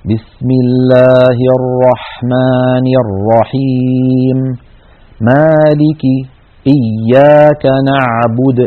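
slow recitation with the alif of الرحمن and مالك opened moderately; moderate mouth opening. Shown as a demonstration. Teacher demonstrates correct Quran recitation to students, open-mouthed. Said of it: "وإنما فتحا وسطا، هكذا".

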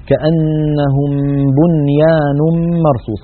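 slow measured Quran recitation. Shown as a demonstration. Teacher recites the verse to his students. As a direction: chant the verse slowly and evenly.

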